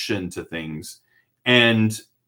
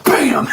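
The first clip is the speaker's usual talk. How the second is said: mock slam noise; 5 dB louder than usual. Ball slammed hard.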